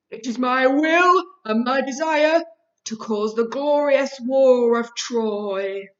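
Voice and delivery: Zeus announcing his war to the playground; window-rattling voice. A narrator's reading. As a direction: proclaim it to shake the windows. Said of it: "said in that window-rattling voice".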